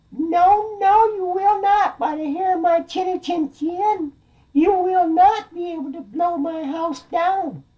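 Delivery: loud, very high falsetto piglet; defiant little piggy. Acted. Piggy swearing the wolf won't get in, shrieky falsetto defiance. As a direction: squeal a stubborn refusal.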